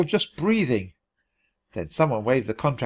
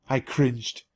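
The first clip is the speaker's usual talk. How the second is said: ordinary delivery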